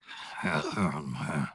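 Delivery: low, soft sigh; soft exasperated sigh; weary sigh. Acted. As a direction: let out a quiet, weary breath. Weary after fruitless questioning.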